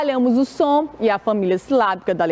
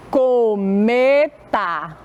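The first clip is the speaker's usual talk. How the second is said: "cometa" in long pieces, co, me, ta; syllable-by-syllable reading; much slower than usual. Shown as a demonstration. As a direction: sound out "cometa" very slowly. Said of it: "Vamos ler com a tia".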